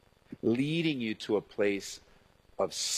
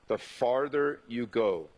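usual speaking voice

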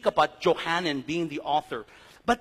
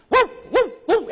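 high, loud woofs; imitated dog barking; 13 semitones higher, 8 dB louder than usual. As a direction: bark "woo" like neighborhood dogs. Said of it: "our dogs have a certain symphony of, of, of barking".

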